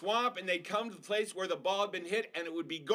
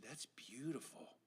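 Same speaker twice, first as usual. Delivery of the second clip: slow, hushed whisper; awed whisper; 3 semitones lower, 15 dB quieter, slower than usual. Acted. Boy whispering about the flower, slow and hushed. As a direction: breathe it in wonder.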